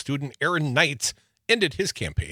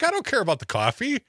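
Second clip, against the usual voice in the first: exasperated; 9 semitones higher, faster than usual. Retold or imitated. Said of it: "I'm like".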